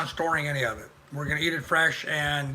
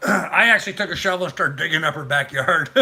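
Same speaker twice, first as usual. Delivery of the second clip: usual speaking voice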